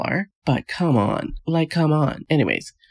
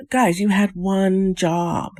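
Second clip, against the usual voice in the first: slow, higher, dragged-out scolding; exasperated scolding; 6 semitones higher, much slower than usual. Acted. Vlogger scolds the couple on the show, exasperated and slow. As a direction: spell it out slowly like to children.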